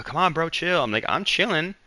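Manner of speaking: acted-out bro exchange, pitched well up; high, playful retold back-and-forth; mock bro banter. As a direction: tease a bro in a high put-on voice. Acted exchange telling a bro to chill.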